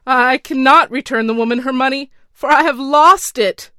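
a scared official confessing, voice trembling; shaking voice. Acted. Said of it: "replied the wretch in a shaking voice".

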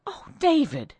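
high, pleading cry; frightened wife crying out. Acted. Wife cries out.

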